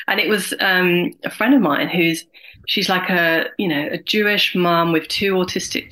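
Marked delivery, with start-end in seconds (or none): none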